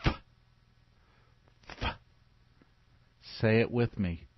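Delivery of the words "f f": long hissed f, no vowel; just the f sound, breathed out; f sound alone; isolated f sound. Shown as a demonstration. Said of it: "F makes this sound".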